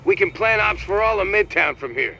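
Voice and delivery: eager, upbeat approval; excited. Acted. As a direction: show it off like a kid with a new toy. New situation room unlocked.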